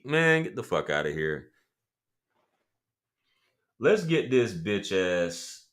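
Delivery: normal talking voice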